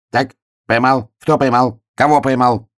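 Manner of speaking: высокие отрывистые вопросы; спрашивай тоненько, соображая на ходу; недоумевающий заяц. A narrator's reading. Заяц разбирает объявление. (озадаченный заячий голосок)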